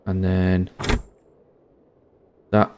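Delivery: ordinary talk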